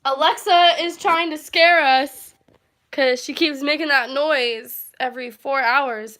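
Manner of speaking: shaken and loud. Real, not acted. Still shaken after the scare.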